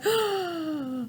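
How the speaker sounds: long, soft gasp of surprise; acted shocked intake of breath; surprised gasp. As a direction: suck in breath as if startled. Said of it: "I have a surprise face".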